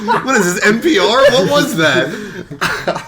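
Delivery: high-pitched, laughing disbelief; laughing incredulously. Real, not acted. Co-host mocks the radio-style welcome, laughing incredulously. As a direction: crack up while asking.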